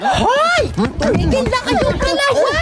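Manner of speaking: put-on high, sharp yell to stop the fighting kids; scolding parent. Mother scolds her two quarreling daughters, high yell. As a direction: shout them apart like an exasperated mother.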